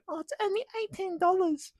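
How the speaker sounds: a smug whiny mimic, very high; mocking crony. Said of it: "They go".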